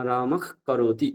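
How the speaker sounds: রামক ends in a drawn-out throat খ before করতি; jihvamuliya pronunciation. Shown as a demonstration. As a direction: slowly turn the breath after রামক into a kh. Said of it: "জিহ্বামূলীয় এবং উপধ্মানীয়". (জিহ্বামূলীয় উচ্চারণ)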